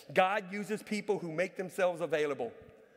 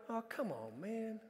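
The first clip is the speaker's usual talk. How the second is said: grumbling aside; 10 dB quieter, slower than usual. Retold or imitated. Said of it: "And I go".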